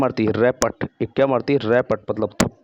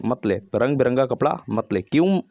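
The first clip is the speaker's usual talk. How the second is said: ordinary talk, nothing marked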